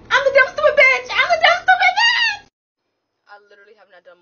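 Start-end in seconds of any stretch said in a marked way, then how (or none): 0.1-2.5 very high, loud delighted shrieking; ecstatic fan squeal. Acted. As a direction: squeal it like a thrilled, starstruck fan. Thrilled fan echoing celebrity's insult.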